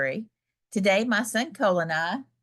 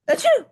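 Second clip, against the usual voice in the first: high, loud hammed-up achoo; big theatrical sneeze; 6 dB louder than usual. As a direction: blast out a cartoon sneeze. Gerald sneezes in the story.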